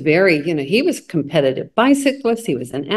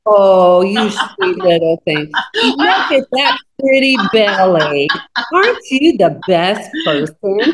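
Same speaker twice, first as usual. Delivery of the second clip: slow, louder cooing pet-owner voice, laughing by the end; doting dog talk; 3 dB louder than usual. Acted. Friend acts out talking to herself like her dog, slow doting pet voice. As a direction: gush over yourself like a pet. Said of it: "I talk to myself like I talk to my dog".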